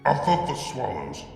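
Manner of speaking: ordinary delivery, nothing marked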